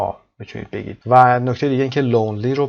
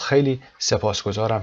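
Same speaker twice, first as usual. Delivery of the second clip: ordinary talk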